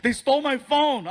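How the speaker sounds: overacted distraught son; devastated. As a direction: wail the bad news to Dad, hammed up. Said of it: "he's all devastated".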